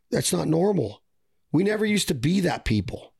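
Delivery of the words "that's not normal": ordinary delivery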